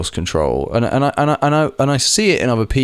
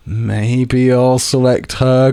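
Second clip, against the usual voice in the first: reluctant pick; 3 semitones higher, much slower than usual. Retold or imitated. Acting his own reluctant choice on line.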